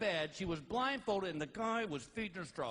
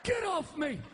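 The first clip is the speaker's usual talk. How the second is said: wife shouting at husband who fell on her; mock yell; 7 semitones higher than usual. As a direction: shout like she's been crushed. Wife crushed yelling.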